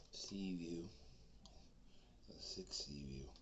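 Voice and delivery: hushed mock girl; whisper. The girl telling him where she lives, a whisper. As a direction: whisper the address shyly.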